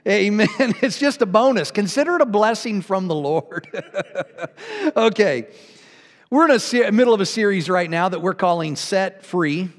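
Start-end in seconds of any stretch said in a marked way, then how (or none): 3.7-4.9 laughing. Real, not acted. Laughing after blessing quip.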